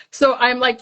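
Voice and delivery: normal talking voice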